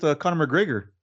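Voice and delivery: ordinary talk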